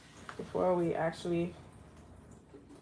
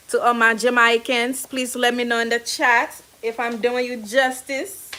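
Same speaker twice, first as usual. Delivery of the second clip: put-on Jamaican accent; 3 semitones higher, 12 dB louder than usual. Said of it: "Sorry for my bad Jamaican accent".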